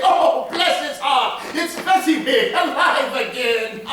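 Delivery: put-on overjoyed, bubbling with laughter at old memories; excited old man. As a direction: Scrooge delighted, laughing and crying out. Scrooge recognises his boyhood workplace.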